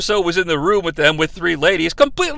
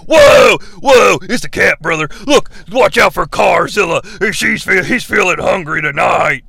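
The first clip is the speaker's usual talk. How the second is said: Hogan voice; 3 semitones lower than usual. Retold or imitated.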